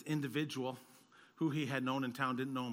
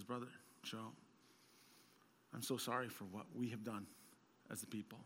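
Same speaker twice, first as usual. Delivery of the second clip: tender apology; 3 semitones lower, 10 dB quieter than usual. Retold or imitated. Japanese pastor's quiet apology.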